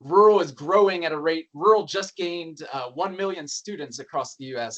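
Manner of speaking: punchy imagined news headline. Pretend. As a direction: announce it like a breaking headline. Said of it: "some interesting news headlines".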